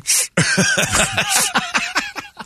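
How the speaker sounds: shrill giggle way up high; storyteller cackles at the ruined ceiling; high-pitched cackle. Retold or imitated. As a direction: burst into a squeaky laugh.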